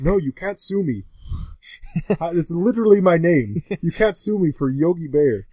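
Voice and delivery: put-on Canadian accent. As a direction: play Justin Long in a Canadian accent. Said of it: "Got a good Canadian accent going".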